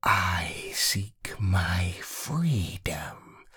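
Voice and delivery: slow, soft whisper; hushed voice. Acted. Rowena confesses her wish, slow hushed whisper. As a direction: breathe it out, barely audible. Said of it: "in a hushed voice".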